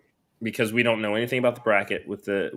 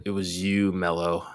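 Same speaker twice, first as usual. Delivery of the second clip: Shawn Michaels; 2 semitones lower, slower than usual. Acted. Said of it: "that was my best Shawn Michaels impression".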